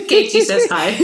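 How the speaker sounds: high, giggly greeting; laughing. Real, not acted. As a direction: say it through giggles. Laughing while relaying a greeting.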